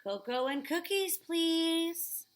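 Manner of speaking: high, loud eager request; eager kid order. Acted. Boy ordering treats at the cafe, eager and high. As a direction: ask like an excited child.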